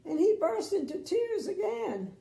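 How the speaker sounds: high, loud, tearful telling; dramatic tearful reading. Acted. Reading to a child, playing up the tears. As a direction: ham up the tears. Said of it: "I may be being a little too dramatic".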